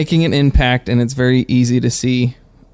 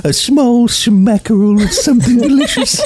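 Pooh Bear voice; 8 semitones higher, 3 dB louder than usual. Pretend. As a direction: cuddly bear talking. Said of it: "I got the Pooh Bear voice down for sure".

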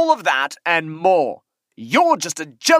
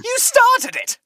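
bratty playground comeback; childish retort. Acted. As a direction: throw it back like a sulking kid.

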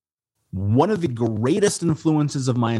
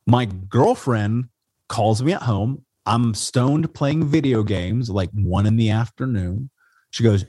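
ordinary talk, nothing marked

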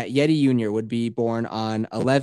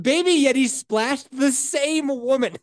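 laughing punchline; 11 semitones higher, 4 dB louder than usual. Real, not acted. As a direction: stretch it out while cracking up.

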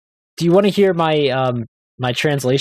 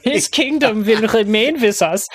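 slow, much higher hammed-up German accent; mock German accent; 9 semitones higher, slower than usual. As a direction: ham up a German accent for laughs. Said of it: "if you read that with a German accent".

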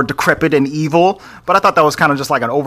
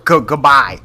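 ordinary delivery

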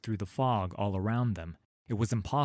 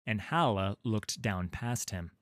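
ordinary delivery

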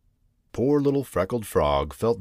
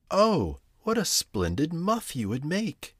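mock excited cry, higher; a touch of storybook delight; 9 semitones higher than usual. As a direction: burst out with a bright idea. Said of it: "she cried".

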